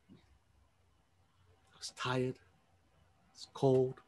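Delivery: very slow, drained, dragging words; exhausted. Acted. Worn-out soldier lists his misery, very slow and drained. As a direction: let each word sag with exhaustion.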